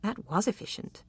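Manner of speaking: soft murmur; quiet amused murmur. A narrator's reading. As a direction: murmur it half to herself. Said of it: "she murmured".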